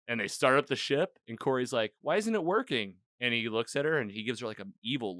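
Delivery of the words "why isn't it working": higher-pitched, bewildered question; mildly mock-alarmed query; acted puzzlement. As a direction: imitate someone baffled by a failure. Voicing Corey as ship fails.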